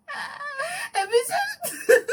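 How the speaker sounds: very high greeting, breaking into laughter; helpless laughing imitation. Caller retrying her greeting to Michelle, helplessly giggly and high. Second try at retelling, laughing.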